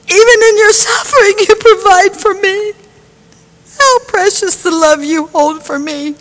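ordinary talk

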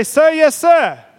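loud, high drill shout; drill sergeant response. Shown as a demonstration. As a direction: bark it like a recruit. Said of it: "you've got to say".

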